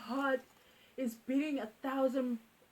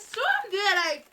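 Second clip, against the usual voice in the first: ordinary talk, nothing marked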